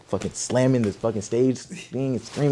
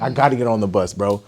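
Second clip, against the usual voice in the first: louder, pushing the point; loud insistence; 9 dB louder than usual. Retold or imitated. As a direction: insist on it to their faces. Repeated louder take of protest.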